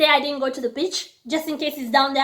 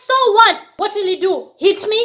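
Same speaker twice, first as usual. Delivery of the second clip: higher, louder scornful challenge; defiant retort; 6 semitones higher, 3 dB louder than usual. Retold or imitated. Shrugging off the waitress's warning, higher and louder. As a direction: dare him, scoffing.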